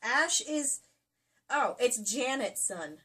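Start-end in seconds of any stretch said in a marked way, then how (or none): none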